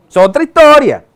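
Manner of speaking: loud, high exclamation; big emphatic declaration; emphatic aside. Real, not acted. Emphatic aside, then softly repeated.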